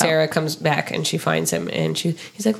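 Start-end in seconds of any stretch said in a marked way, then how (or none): none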